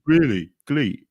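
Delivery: exaggerated Brummy, high and drawn out; Brummy accent. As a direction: imitate a Birmingham accent. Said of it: "I'm trying to do a, a Brummy accent".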